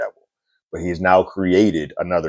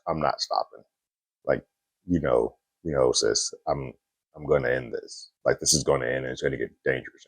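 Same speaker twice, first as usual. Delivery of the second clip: quiet menace; 4 semitones lower, 8 dB quieter than usual. Retold or imitated. Louis's look voiced as threat.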